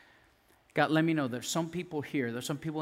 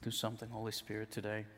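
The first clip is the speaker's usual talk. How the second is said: softer, lower prayer to the Spirit; quiet prayer; 5 semitones lower, 7 dB quieter than usual. Real, not acted. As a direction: invite the Spirit hushed. Quiet prayer invoking Holy Spirit.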